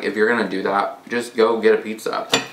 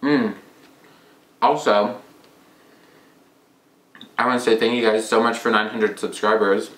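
talking with mouth full; chewing food. Real, not acted. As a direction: between bites. Seen 3: Bites carrot at -3; cheeks and lips chew through labelled speech.

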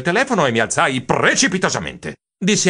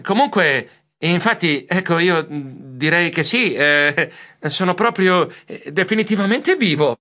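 halting, raised, awkward hemming; embarrassed murmur; 4 semitones higher, slower than usual. Acted. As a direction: stumble apologetically between hesitations. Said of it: "ero piuttosto imbarazzato".

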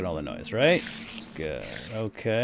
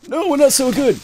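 squeaky silly voice; 13 semitones higher, 11 dB louder than usual. Pretend. Answers own question in a voice.

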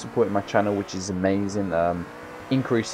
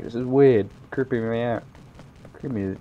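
usual speaking voice

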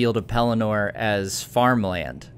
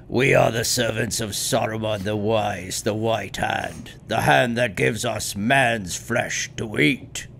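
orc voice. Acted. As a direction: read it like a snarling orc. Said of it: "I'm gonna need you to speak in an orc, uh, uh, voice".

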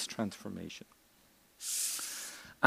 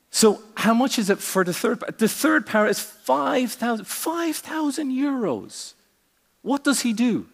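high-pitched, mounting disbelief; hammed-up incredulity, pitched far above usual; astonished customer; 11 semitones higher, 3 dB louder than usual. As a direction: play a joke customer staggered by the price. Customer astonished by price in joke.

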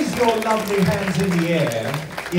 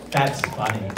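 deadpan; 6 semitones lower than usual. Acted. Repeating own joke two ways.

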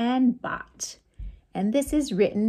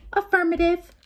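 higher, louder robotic affirmative; 8 semitones higher, 4 dB louder, faster than usual. Acted. Robot agreeing to play with the boy. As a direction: answer like a cheerful robot.

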